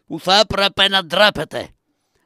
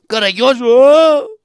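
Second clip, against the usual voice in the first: rising cry into a loud, high howl; mounting theatrical cry; calling out; 5 semitones higher, 7 dB louder, slower than usual. Acted. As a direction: call the name, then let it swell into a howl. Crying out for the dead man.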